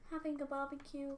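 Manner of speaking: usual speaking voice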